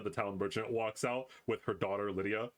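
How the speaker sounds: ordinary talk, nothing marked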